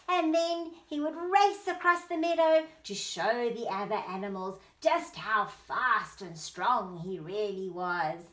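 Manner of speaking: plain reading voice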